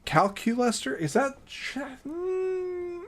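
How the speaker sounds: Calculester? tried out as a puzzled high question; testing how Calculester is said, trailing into a hum; wondering aloud how to say Calculester; unsure of the name. Real, not acted. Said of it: "am I putting emphasis on the wrong syllable there".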